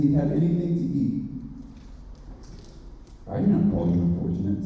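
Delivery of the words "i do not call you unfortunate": low, grave lion voice; lion's voice. A narrator's reading. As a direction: answer gently from deep down. Lion's voice in read story.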